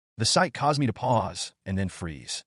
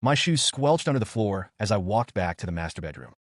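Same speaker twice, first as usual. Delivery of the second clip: plain reading voice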